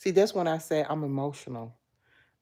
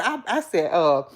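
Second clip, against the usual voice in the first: normal talking voice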